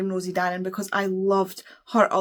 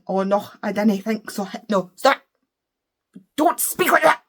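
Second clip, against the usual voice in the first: exasperated outburst; slower than usual. Acted. Mimics written dialect, then rages.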